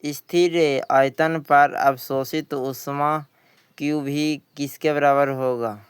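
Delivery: ordinary talk, nothing marked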